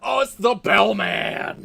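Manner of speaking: loud, slow excited shout. Acted. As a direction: call out the discovery with a loud drawn-out shout. Spotting the bellman character.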